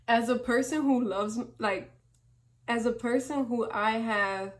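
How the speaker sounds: ordinary delivery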